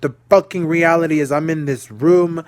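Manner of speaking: fed-up rant about the room; frustrated outburst. Real, not acted. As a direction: let the anger push the words out. Venting frustration at situation.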